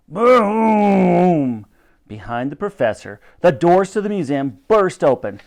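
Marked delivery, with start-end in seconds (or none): 0.1-1.6 long, loud, higher boom; loud imitated boom. A narrator's reading. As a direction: boom it out like an impact. Narrator voices a second crash.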